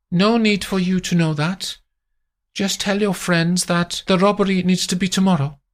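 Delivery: high, cold command; high voice, curt; Amandine. Acted. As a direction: shut down the question.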